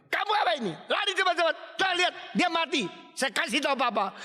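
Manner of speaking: high-pitched, shouted threat; bullying, high and yelled; scolding older sibling. Acted. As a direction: shout an accusation at a little kid. Older brother's scolding threat in story.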